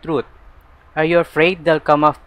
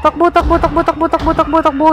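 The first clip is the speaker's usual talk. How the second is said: very high, loud frantic screams; panicked shouting; 7 dB louder than usual. Real, not acted. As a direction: scream urgent commands while fleeing for your life. Fleeing in a horror game chase. (natatarantang sigaw habang tumatakas)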